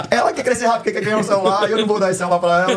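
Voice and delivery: high-pitched words through real laughter; laughing exclamation. Real, not acted. Dad reacts to daughter's wish for a phone, laughing. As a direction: burst out, amused and incredulous.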